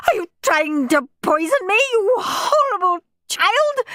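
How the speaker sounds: high-pitched choking accusation; choked, indignant cry, slowing at the end; choked outrage. A narrator's reading. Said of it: "she choked".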